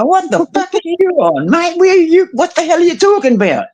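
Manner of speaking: high, indignant mock outburst; retold heated comeback, voice pitched high; incredulous retort. Retelling his own heated reply.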